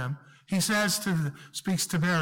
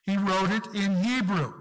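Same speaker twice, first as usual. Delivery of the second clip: ordinary delivery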